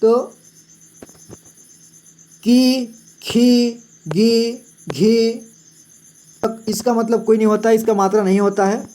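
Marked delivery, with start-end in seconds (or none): 2.4-5.5 की खी गी घी each with a long held ee, very slow; long ee drawn out. Shown as a demonstration. Teacher reading the alphabet to students, long ee. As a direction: hold every ee in की खी गी घी. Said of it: "ज़्यादा देर तक इसका उच्चारण करना है".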